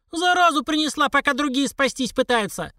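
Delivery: put-on high, squealing old woman; squeaky falsetto. A narrator's reading. Second old woman accusing the cougher, squeaky falsetto. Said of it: "пропищала фальцетом вторая".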